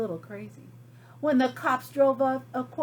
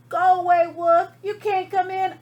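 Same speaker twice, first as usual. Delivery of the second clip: shouted from inside, high; yelling; 6 semitones higher, 9 dB louder than usual. Retold or imitated. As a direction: yell through the door. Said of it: "He yelled back".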